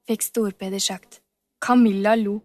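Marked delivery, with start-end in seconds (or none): none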